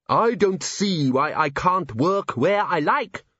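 very slow, aggrieved vampire; drawn-out complaining vampire; whiny vampire. A narrator's reading.

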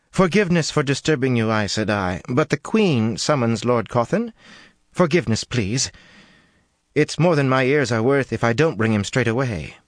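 anxious servant. A narrator's reading. Breathless servant begs Aes Sedai.

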